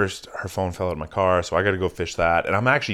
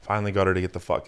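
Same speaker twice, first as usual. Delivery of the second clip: ordinary talk, nothing marked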